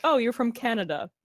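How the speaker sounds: ordinary talk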